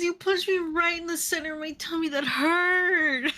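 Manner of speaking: put-on high babyish complaint breaking into a laugh; whiny villain. Villain complains about his tummy to the hero, high and whiny. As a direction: whine it like a hurt child. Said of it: "keeps whining".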